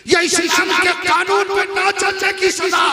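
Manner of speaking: preaching shout. Real, not acted. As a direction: thunder the verdict at the crowd. Preacher's emphatic condemnation.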